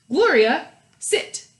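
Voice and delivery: police officer telling his dog to sit; officer's command. Acted. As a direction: give the dog a crisp order.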